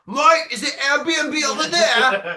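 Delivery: high, loud, slow pleading tourist voice; Australian tourist. Retold or imitated. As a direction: mimic a lost tourist begging for directions. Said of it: "and be like".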